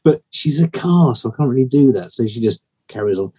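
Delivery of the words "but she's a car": ordinary talk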